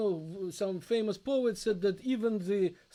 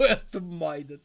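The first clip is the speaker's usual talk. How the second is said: laughing; 4 semitones lower than usual. Real, not acted. Repeats son's word laughing.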